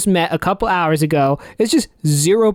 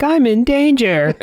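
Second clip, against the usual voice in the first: slow, higher, lightly mock-innocent; Simpsons meme quote; 6 semitones higher than usual. Quoting the Simpsons meme to the hosts, slow and mock-innocent. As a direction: drawl out the meme line a touch higher. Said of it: "that meme where, from The Simpsons".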